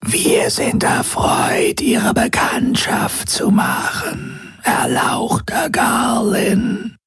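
slow, eerie whispered creature voice; drawn-out, rustling otherworldly whisper; voice like trickling sand. A narrator's reading. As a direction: whisper it very slowly, like sand running. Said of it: "mit einer Stimme wie rieselnder Sand".

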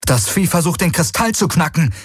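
high-pitched, urgent exclamation; alarmed realization. A narrator's reading. Pilot warns crewmate about the crystal, alarmed. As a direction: cry out the sudden danger.

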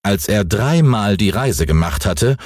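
ordinary delivery

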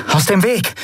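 high, demanding order. Acted. Endriel demands pirates clear the path, demanding. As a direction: make them step aside. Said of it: "forderte Endriel".

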